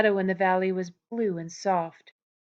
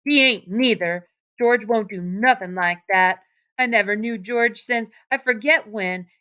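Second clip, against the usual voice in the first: louder defiant retort; Lenny defending George against the rabbit, louder; belligerently; 3 semitones higher, 8 dB louder than usual. A narrator's reading. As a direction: push back hard, defending George. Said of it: "Lenny retorted belligerently".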